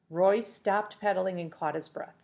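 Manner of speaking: usual speaking voice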